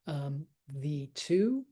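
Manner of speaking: usual speaking voice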